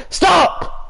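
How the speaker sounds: high piercing stop; sharp yell. Real, not acted. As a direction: cut him off with a yelp. Yelling at teammate to stop.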